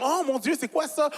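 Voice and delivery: high-pitched acted astonishment; mock amazement. Visitor discovering the host's DVD collection, mock amazement. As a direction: gasp it out high, playing amazed.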